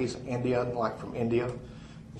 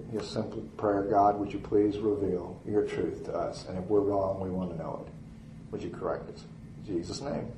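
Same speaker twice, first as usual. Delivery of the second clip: retold prayer, low and soft; simple prayer; 4 semitones lower, 7 dB quieter than usual. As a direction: pray it quietly, dropping down to the close. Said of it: "Would you pray with me".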